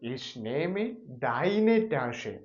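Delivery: slow dictation. Shown as a demonstration. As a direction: dictate each word for writing. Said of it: "അപ്പോൾ എങ്ങനെ എഴുതും?".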